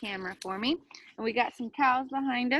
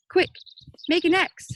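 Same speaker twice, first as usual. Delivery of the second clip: higher, louder urgent call; urgent yell; 8 semitones higher, 8 dB louder than usual. Acted. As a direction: bark out the order to the scouts. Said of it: "Emmett yelled out".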